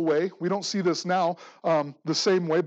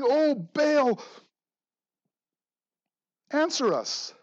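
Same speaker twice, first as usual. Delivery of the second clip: mock desperate cry; 8 semitones higher, 5 dB louder, slower than usual. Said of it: "they're screaming out and hollering".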